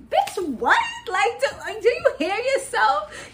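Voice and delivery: high-pitched, laughing disbelief; incredulous retort. Acted. Vlogger calling out a castmate who plays innocent, incredulous. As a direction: pitch leaps up in mock disbelief.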